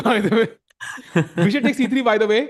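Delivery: laughing through the words. Real, not acted. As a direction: talk while still laughing. Laughing after blitz joke.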